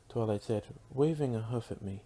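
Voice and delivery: plain reading voice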